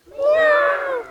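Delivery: high, loud falling meow; scared cat meow. Pretend. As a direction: squeal it like a frightened kitten. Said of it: "And then it's a scared cat".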